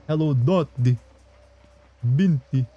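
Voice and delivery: put-on, halting Transylvanian accent; botched Transylvanian. Streamer greeting himself as chat, accidental Transylvanian accent. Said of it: "I sound like I'm trying to do".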